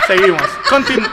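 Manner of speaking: retomar el hilo todavía riendo; voz más aguda, con la risa encima; entre risas reales. Real, not acted. Retoma el tema tras reírse. (aguantándose la risa de verdad)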